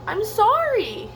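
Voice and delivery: high squeaky little sorry; lightly voiced squeak; squeaky apology. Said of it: "he squeaked".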